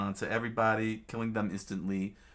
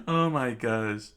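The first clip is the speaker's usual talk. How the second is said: real laughing fit, then a slow gasped exclamation; helpless loud laughter; cracking up; 3 semitones higher, 4 dB louder, slower than usual. Real, not acted. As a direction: burst out laughing and come down slowly. Narrator cracks up at the story.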